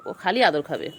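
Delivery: ordinary delivery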